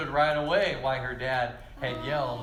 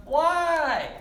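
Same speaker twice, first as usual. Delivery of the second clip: defiant why; 12 semitones higher, 6 dB louder than usual. Retold or imitated. Child talking back to dad.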